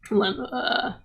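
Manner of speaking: ordinary talk, nothing marked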